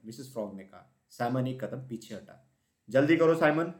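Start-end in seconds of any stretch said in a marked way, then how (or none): 2.9-3.7 loud, high call; mother mouse calling loudly to Simon. A narrator's reading. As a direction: shout across to the dawdler. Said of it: "Mrs mouse चिल्लाई".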